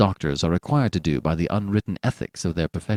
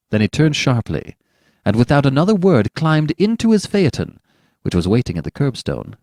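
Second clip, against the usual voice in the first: ordinary delivery, nothing marked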